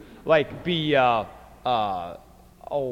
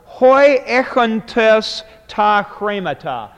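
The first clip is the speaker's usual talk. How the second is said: higher and louder, slowed Greek; emphatic quote; 5 semitones higher, 6 dB louder, slower than usual. Retold or imitated. Teacher voicing Jesus to his disciples, slow and emphatic. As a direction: proclaim it as Jesus would. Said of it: "He says".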